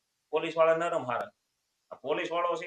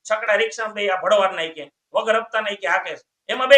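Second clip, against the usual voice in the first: angry doctor ranting; 2 semitones higher, 8 dB louder than usual. Acted. Doctor rants at bus-sick patient, building loud rant.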